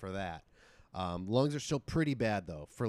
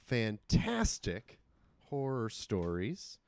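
slow spooky announcer; slower than usual. Acted.

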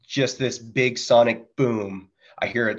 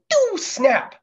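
very high mouth sound of a round hitting metal; imitated bullet snap.

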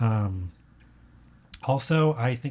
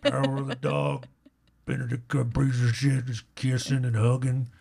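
put-on old cowboy voice; comic cowboy actor impression, drifting as it goes; Sam Elliott. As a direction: grumble like a western movie star. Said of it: "That started as a Sam Elliott impression".

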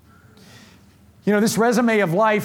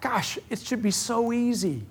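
emotional; 5 semitones higher, 5 dB quieter than usual. Real, not acted. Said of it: "The reason I get emotional".

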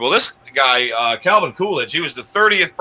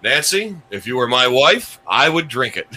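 ordinary delivery

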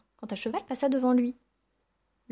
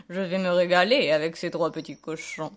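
low storybook wolf, amused; gloating wolf; 4 semitones lower, 4 dB louder than usual. A narrator's reading. Wolf to himself about his meal, gloating. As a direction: relish it as a greedy wolf. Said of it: "se dit-il en riant".

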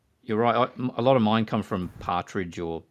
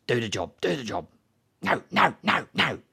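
imitating a curt gangster, the no's higher; mock gangster, insistent, lightly acted; Don Logan impression; 4 semitones higher than usual. Quoting Sexy Beast to friends as the gangster. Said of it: "from, uh, Ben Kingsley".

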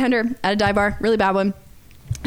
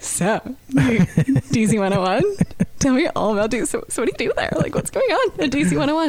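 eager boss; 6 semitones higher than usual. Retold or imitated. Boss fawning over the radio guest. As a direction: play the fawning boss digging for gossip. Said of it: "my boss is like".

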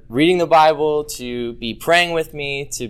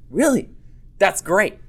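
surprised delight; 6 semitones higher than usual. Retold or imitated. Parents react with excitement.

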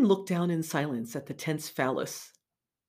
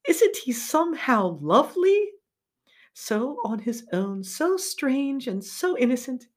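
lightly voiced woman, pitched higher; tender woman's voice; 6 semitones higher, 4 dB louder than usual. Connie voiced in read passage.

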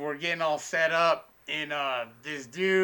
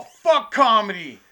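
louder and slower spit-out curse; mock angry retort; 4 semitones higher, 9 dB louder, slower than usual. Cranky old man dismissing the comedy show, louder mock scorn. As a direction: drag out the insult with contempt.

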